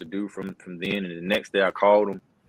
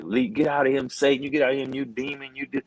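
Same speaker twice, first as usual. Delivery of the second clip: raised-pitch mock exorcism prayer; imitating praying wife; 6 semitones higher than usual. Wife praying over her husband on the phone, imitated urgent command. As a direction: lightly act out a wife casting out a demon. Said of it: "now she's praying, and she's like".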